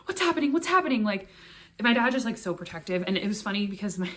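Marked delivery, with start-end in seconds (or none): 0.1-1.0 imitating a panicked father, much higher; frantic, high what's happening from a worried dad; alarmed dad. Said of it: "It was just like".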